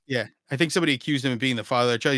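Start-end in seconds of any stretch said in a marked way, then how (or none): none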